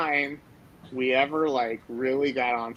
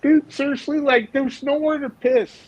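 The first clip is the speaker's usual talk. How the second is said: much higher, louder, pleading disbelief; exasperated pleading; 14 semitones higher, 5 dB louder than usual. Retold or imitated. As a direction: plead with your partner to be reasonable. Arguing with partner, exasperated.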